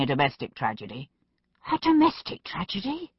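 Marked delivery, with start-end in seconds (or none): 1.7-3.1 high-pitched indignant question; rising outrage. A narrator's reading. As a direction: echo it back in outraged disbelief. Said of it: "Macbeth's voice rose in outrage".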